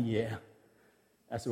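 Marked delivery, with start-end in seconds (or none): 0.0-0.3 low chuckled yeah; retold smiling chuckle; smiling reply. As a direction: imitate a small amused chuckle, then agree. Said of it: "he smiled and went".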